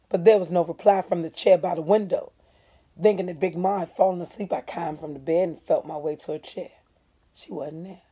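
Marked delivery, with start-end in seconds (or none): none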